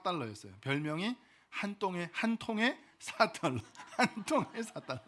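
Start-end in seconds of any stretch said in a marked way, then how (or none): 2.1-5.1 laughing through the repeated nickname; tickled by a silly catchphrase; amused laughing. Real, not acted. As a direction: say it higher, breaking into a chuckle.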